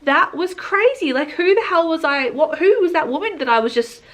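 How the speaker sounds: high and loud, amused at herself; giddy disbelief. Retold or imitated. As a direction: laugh off the ordeal, wide-eyed. Marvels at her own wailing.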